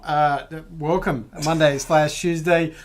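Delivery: ordinary talk, nothing marked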